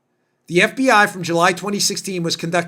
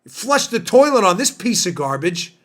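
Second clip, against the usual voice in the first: ordinary talk, nothing marked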